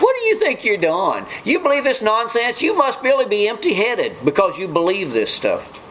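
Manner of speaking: high-pitched, incredulous ridicule, acted out; mock scoffing. Scoffers ridiculing someone who believes in Jesus, mock incredulous. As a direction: imitate scoffers belittling a believer.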